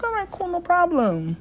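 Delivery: mock chipper agreement. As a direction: sound breezy and overly agreeable. Chirpy reply to the insurer.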